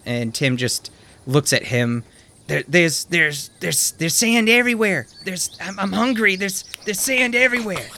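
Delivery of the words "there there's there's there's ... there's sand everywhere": high, whimpering complaint; much higher, whimpering old man; whiny old man. Acted. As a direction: whine like a man about to cry. Tim whining before he cries.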